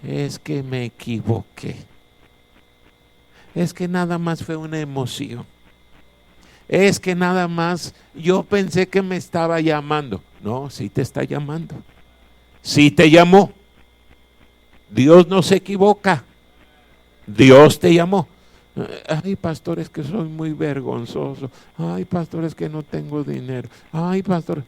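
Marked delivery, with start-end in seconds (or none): 0.0-10.2 mock excuse-maker. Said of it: "alguien diga". 19.1-24.6 whiny believer. Retold or imitated. Pastor mimics complaining believers.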